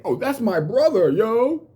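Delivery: mock bragging. Hypothetical quote of acquaintances bragging.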